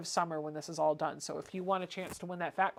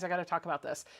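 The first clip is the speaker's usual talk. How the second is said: usual speaking voice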